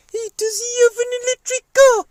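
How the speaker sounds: very high, loud, squeaky puppet sidekick. Pretend. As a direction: pipe up as a cheeky little puppet. Puppet voice joins the chat.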